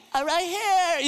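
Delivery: put-on high-pitched little boy's protest; imitated upset child. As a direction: play a hurt kid answering dad. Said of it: "got tears in his eyes".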